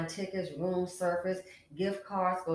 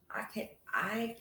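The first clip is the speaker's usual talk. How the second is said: whisper; 3 semitones higher, 6 dB quieter than usual. Real, not acted. Stunned at tiny child support.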